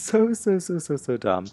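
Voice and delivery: squeaky, exaggerated so dumb; high mocking emphasis. Real, not acted. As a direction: pile on the so in a high voice.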